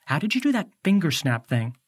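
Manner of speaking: ordinary delivery, nothing marked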